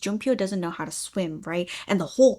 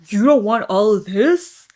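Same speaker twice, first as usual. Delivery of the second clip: cocky offended guy; 9 dB louder than usual. Retold or imitated. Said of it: "that's not how he sounds".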